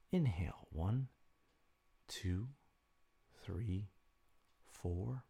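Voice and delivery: soft, slow counting of breaths; guided breathing count. Acted. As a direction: count the breaths calmly and softly.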